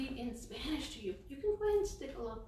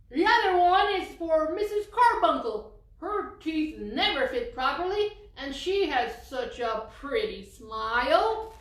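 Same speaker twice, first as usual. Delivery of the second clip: louder, higher proud grandpa; 3 semitones higher, 9 dB louder than usual. Acted. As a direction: boast fondly about his friend's smile.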